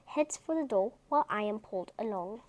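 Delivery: plain reading voice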